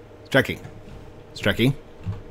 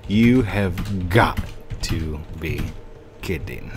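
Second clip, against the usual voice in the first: slow, spaced-out words sinking lower; deflated disbelief; 3 semitones lower, slower than usual. Real, not acted. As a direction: let it sink word by word in disbelief. Exasperated, slows right down.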